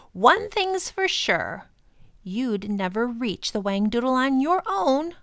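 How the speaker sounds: loud, high bad-tempered creature voice. Proc vowing to stop the professor and children, loud bad-tempered voice. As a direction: bristle and threaten. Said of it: "looking bad-tempered".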